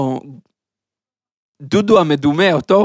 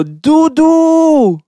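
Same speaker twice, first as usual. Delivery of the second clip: high, loud comic shout of a name; retold shout; 11 semitones higher, 9 dB louder, much slower than usual. Joker yelling at the bald giant as if at his friend. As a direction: yell it like greeting an old friend across the hall. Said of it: "והוא צועק לו".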